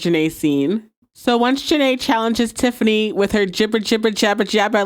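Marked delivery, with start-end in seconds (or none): none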